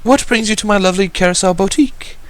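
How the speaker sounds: high, polished, elegant hostess voice; eloquent lady. Acted. Boutique owner welcomes a guest, high and eloquent. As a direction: ask it with refined charm. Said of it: "she asked eloquently".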